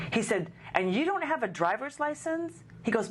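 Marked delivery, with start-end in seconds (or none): 0.7-2.6 high, disbelieving question; mock the clerk's shocked disbelief; incredulous clerk.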